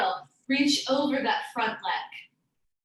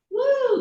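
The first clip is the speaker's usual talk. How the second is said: cheering woo; 12 semitones higher, 6 dB louder than usual. Real, not acted. Teacher cheering the class on.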